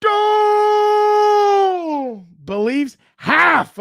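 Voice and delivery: milk every word with mock disbelief; a long, sarcastic high shout; drawn-out disbelief. Mocking doubt of a rival.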